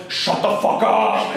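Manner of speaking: drawn-out heckling yell; mock shout. The whole convention shouting down Robespierre, mock-angry yell. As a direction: shout him down, stretching the words slowly. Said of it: "start shouting at him".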